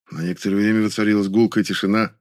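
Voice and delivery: usual speaking voice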